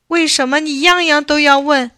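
put-on high, loud childish outburst; exasperated. A narrator's reading. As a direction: protest like a boy fed up with questions. Boy snaps at old man's questions. (不耐烦地高声顶撞)

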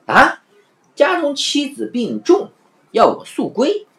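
a high startled gasp, then reading the news slowly; surprised. Acted. Afanti reading a sudden letter, high and surprised. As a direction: caught off guard by the letter.

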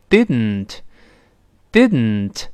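very slow didn't, tongue stays up and air leaves the nose; nasal-plosive didn't. Shown as a demonstration. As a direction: say didn't as did-n-t, no vowel after the second d. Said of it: "舌尖没有分开，鼻子出气儿". (慢读didn't，鼻子出气儿)

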